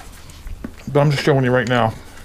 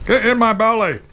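playful greedy eagerness; 10 semitones higher than usual. Real, not acted. About to eat, playful.

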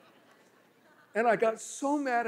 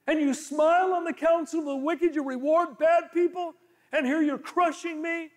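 Job pleading; 6 semitones higher, 5 dB louder than usual. Retold or imitated. Preacher voicing Job accusing God.

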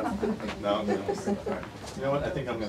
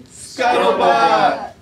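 very loud, high, long drawn-out yell; group shout; 10 semitones higher, 15 dB louder, much slower than usual. Shown as a demonstration. Author leads children after counting to three, gleeful shout. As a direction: holler the silly word with a crowd. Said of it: "count to three when all can say".

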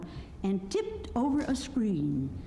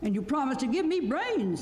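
lightly voiced higher scarecrow; 6 semitones higher than usual. A narrator's reading. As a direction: press the claim in a raised pitch. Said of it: "said the Scarecrow".

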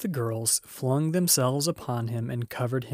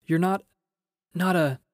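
mildly shaky, higher-pitched, halting; 6 semitones higher, 4 dB louder than usual. A narrator's reading. Susan unable to say the word ghost, lightly shaky voice. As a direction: break off nervously, unable to finish. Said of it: "asked Susan in a shaky voice".

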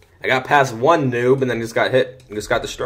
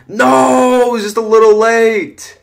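higher, much louder frustrated cry; frustrated shout; 10 semitones higher, 8 dB louder, slower than usual. Real, not acted. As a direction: yell it out as the sack slips away. Just missed the sack.

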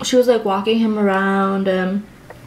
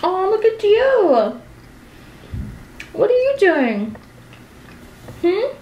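high, slow cooing at the dog; doting pet voice, much higher and slow; 12 semitones higher than usual. Real, not acted. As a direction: coo sweetly to your puppy.

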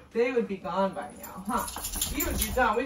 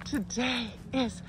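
vlog intro; 5 semitones higher, much slower than usual. Acted. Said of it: "let's start over".

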